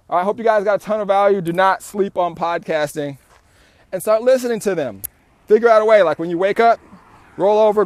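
loud, high, pumped-up outro; excited sign-off. Real, not acted. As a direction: hype up the viewers. Energetic sign-off to viewers.

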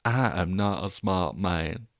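slow, drawling Southern American; a lightly done Forrest Gump; a hint of Forrest Gump, slow. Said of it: "That was Forrest Gump".

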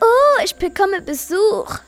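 high, sing-song witch's greeting; surprised fluting woman; fluting surprise. A narrator's reading. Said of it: "flötete erstaunt".

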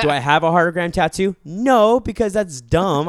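scoffing. Real, not acted. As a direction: slam the idea down. Scoffing at his own teen plan.

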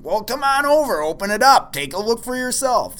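a loud, half-playful imitation of the clerk; mock call-out. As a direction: call him over, hammed-up and pushy. Clerk urging him to look.

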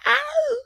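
very high drawn-out "ow"; howl of pain. Acted. Wolf in terrible pain, high yelping howl. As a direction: cry out high like a hurt wolf. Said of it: "just in terrible pain".

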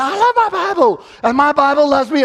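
very high, loud chanted reply; full-voiced crowd response; congregation response. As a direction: answer back like a whole congregation. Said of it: "everyone would say".

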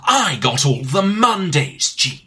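slow, grandiose proclamation; mock-epic game villain reveal; hammed-up villain monologue. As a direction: declaim it, stretching and swelling key words. Hammed-up villain monologue about Garfield.

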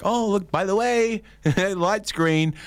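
high, sweetly polite voice; mock polite driver; polite nudge. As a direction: gently point out the green light.

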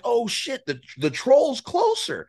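panicked whisper-to-buddy urgency, but voiced; alarmed excitement. Hunter realizing the bull is closer, alarmed excitement.

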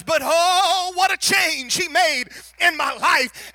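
shouted, rising preacher's cry; preaching at full force. Acted. Preacher pleading with the congregation, full-force shout. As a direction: build it up to a shout.

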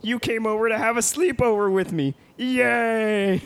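put-on high gleeful man-child; squeaky excited cheer; Pee-wee Herman voice. Pee-wee greets Mister Bean.

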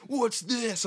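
high-pitched gotcha question; mock accusing. Border guard finding undeclared wine, mock accusation. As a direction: play the guard pouncing on a find.

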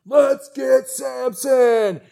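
high, drawn-out party holler; rowdy crowd call. Acted. Partying Philistines call for their captive, rowdy holler. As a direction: call it out slowly, high and loud.

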